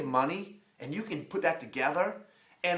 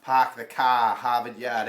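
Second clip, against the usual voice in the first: the famous line in a light Boston accent, the r dropped; 4 semitones lower, 3 dB louder, slower than usual. Shown as a demonstration. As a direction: toss off the old Boston catchphrase, "car" and "Yard" with no r. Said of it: "people want me to say that stupid line".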